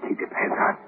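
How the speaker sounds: hushed whisper. Acted. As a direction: whisper it so no one hears. Sneaking out of prison.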